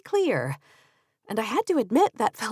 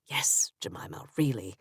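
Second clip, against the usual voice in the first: soft reassurance; 12 semitones lower, 8 dB quieter than usual. Acted. Benjamin softly reassuring on phone.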